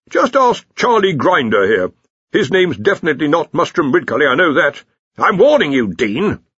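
loud, high blustering threat; blustering warning. A narrator's reading. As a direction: warn him with mounting bluster. Arch-Chancellor warning the dean.

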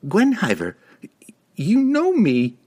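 high, drawn-out pleading; anxious plea. A narrator's reading. Regis backing away from the cat, high anxious plea. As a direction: plead to be recognised. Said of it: "Regis said again".

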